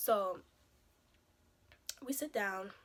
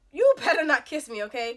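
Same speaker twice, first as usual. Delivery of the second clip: loud, higher-pitched warning; pissed-off warning; 3 semitones higher, 10 dB louder than usual. Acted. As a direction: warn him off firmly and loudly. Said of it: "already done pissed me off".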